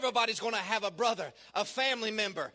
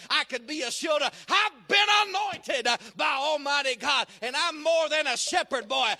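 building to loud, higher-pitched pulpit shouting; full-force preaching; 3 semitones higher, 4 dB louder than usual. Real, not acted. Preacher voices the shepherd's anointed testimony, full-force preaching. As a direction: testify at full voice, punching each phrase.

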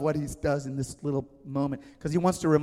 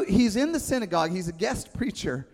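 ordinary delivery

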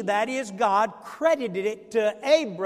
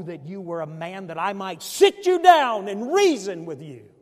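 a louder, pleading outburst; upset outcry; 8 dB louder than usual. Retold or imitated. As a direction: cry out from the midst of suffering. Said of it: "Job becomes so upset in the midst of his suffering".

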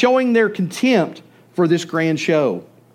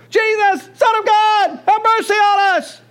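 desperate high shout for mercy, much higher and louder; crying out louder; 11 semitones higher than usual. Acted. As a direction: yell over the crowd so Jesus must hear. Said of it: "start crying out louder".

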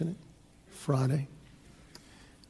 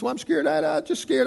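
put-on scared little girl; 8 semitones higher than usual. Little girl woken at night tells daddy, mildly scared. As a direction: lightly voice a frightened little girl. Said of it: "She's scared".

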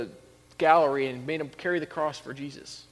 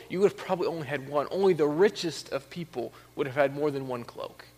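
ordinary talk, nothing marked

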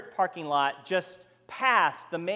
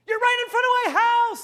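high, loud furious yell; yelling louder; 7 dB louder than usual. Retold or imitated. Homeowner yelling louder at Frank, jabbing his rake, furious. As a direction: bellow it even harder. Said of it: "yelling louder".

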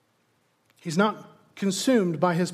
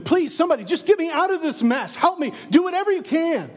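higher, pleading cry for rescue; 7 semitones higher than usual. Pretend. Preacher voicing a desperate prisoner, pleading higher. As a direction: beg somebody to get you out. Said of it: "Would you be pleading with him".

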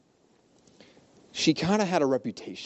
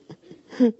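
real laugh; 9 semitones higher, 4 dB louder than usual. Real, not acted. Laughing at his own joke.

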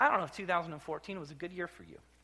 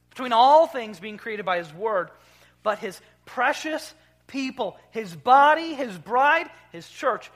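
much higher and louder, rising list; fervent preacher's climax; preaching at full force; 5 semitones higher, 12 dB louder, slower than usual. Acted. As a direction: build each phrase like a proclamation. Preaching on his precious people.

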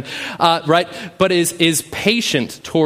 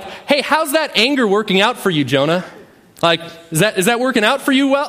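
higher, playful ribbing; God teasing Jonah; 6 semitones higher than usual. Retold or imitated. Preacher voicing God needling angry Jonah, higher teasing tone. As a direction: poke fun at him and rise higher on the last question. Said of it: "God's just like".

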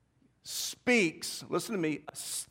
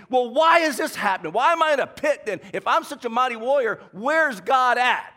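louder, indignant comeback; with an attitude; 5 semitones higher, 5 dB louder than usual. Retold or imitated. Gideon hitting back at the angel with louder attitude. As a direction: snap back, defiant and louder. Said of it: "hits back immediately with an attitude".

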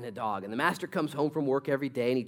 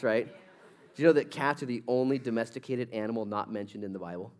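ordinary delivery

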